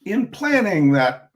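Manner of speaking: ordinary delivery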